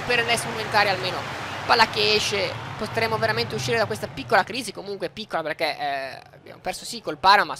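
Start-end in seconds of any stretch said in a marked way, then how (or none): none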